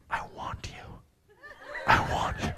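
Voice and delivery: breathy unvoiced whisper; imitating her seductive whisper. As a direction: whisper it like a come-on. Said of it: "she whispered".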